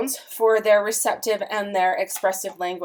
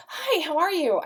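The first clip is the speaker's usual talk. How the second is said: cheerful greeting lifted high; 8 semitones higher than usual. Shown as a demonstration. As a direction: greet family with the voice going up. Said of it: "your voice does go up".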